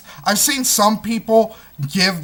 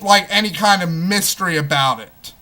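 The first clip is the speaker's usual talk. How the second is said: ordinary delivery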